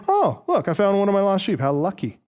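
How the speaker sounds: mock surprise. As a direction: pretend to stumble on a lucky find. Mock imagined line of Jesus.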